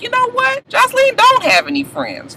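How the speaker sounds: high-pitched voiced realisation; thinking to herself. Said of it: "I started thinking to myself".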